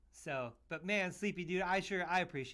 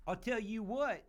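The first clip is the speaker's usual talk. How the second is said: lightly put-on folksy neighbor voice, pitched up; neighbor-style catchphrase; 5 semitones higher, slower than usual. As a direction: playfully imitate a neighbor's pet saying, higher. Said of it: "Sound like my neighbor".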